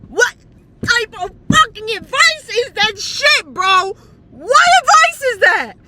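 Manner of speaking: high, loud disbelieving yell; yelling about the friend's bad advice; outraged yell. Real, not acted. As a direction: shout it in outrage.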